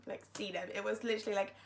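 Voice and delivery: normal talking voice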